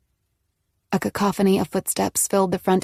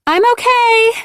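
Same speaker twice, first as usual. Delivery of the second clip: strained, much higher, loud reply; unnaturally high; 9 dB louder than usual. A narrator's reading. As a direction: fake a cheerful okay, too high. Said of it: "her voice unnaturally high".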